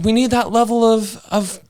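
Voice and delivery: excited. Real, not acted. Continuing the high excited call.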